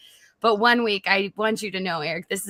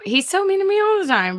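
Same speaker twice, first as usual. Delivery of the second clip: higher, louder whiny complaint; playful complaint; 11 semitones higher, 5 dB louder than usual. Real, not acted. Wife tattling on her husband to his co-hosts, playful complaint. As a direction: complain with rising indignation.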